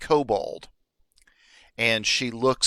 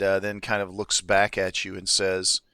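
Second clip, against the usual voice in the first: ordinary talk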